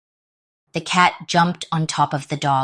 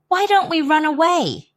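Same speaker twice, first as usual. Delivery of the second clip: much higher, louder cat voice; piped up; 12 semitones higher, 5 dB louder than usual. Acted. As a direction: pipe it up like an eager little cat. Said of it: "the cat piped up".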